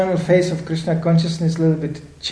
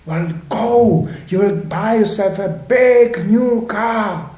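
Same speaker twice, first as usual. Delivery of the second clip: big, drawn-out, higher-pitched sales pitch; hammed-up urging; 5 semitones higher, 4 dB louder, much slower than usual. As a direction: egg them on slowly and grandly. Acted urging voice of materialism.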